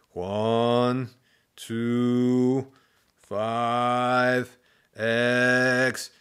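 drawn-out, droning count; halting pupil recital. A narrator's reading. New pupil counts before class, drawn-out and laboured.

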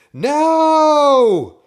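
very high, loud drawn-out no; high panicked scream; terrified shout. A narrator's reading. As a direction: yell it in horror. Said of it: "Drew shouted".

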